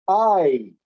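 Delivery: I, gliding from ah to ee; slow diphthong. Shown as a demonstration. Teacher sounding the diphthong for students, slow and loud. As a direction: draw out I so the glide shows. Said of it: "if you say it slowly".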